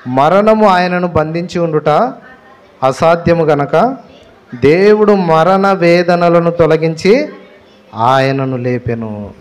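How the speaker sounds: slow, measured Bible reading; scripture reading. Acted. Pastor reading Acts to the congregation, slow and measured. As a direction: read each phrase deliberately, pausing. Reads Bible verse aloud.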